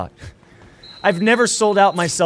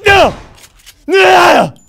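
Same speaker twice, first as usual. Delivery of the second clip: very loud, high-pitched "no" screamed out; anguished yell; 14 semitones higher, 13 dB louder than usual. Real, not acted. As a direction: scream in protest, stretching the second cry. Cries out at a game disaster.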